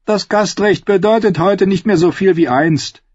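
put-on high-pitched girl's voice; high, hard young voice; stern girl. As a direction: rebuff her in a high girlish voice.

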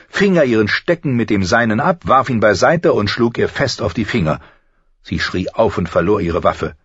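usual speaking voice

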